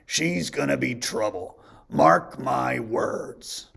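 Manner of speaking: slow, grumbling warning; Grumpy's dire grumble. A narrator's reading. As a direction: grouse suspiciously. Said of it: "said Grumpy".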